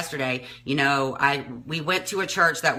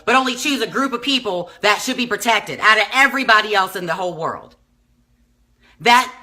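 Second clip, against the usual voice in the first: high, loud, indignant questioning; exasperated outburst; 8 semitones higher, 5 dB louder than usual. Real, not acted. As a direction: argue heatedly, voice raised in disbelief. Real outburst, then apologizes; was mad.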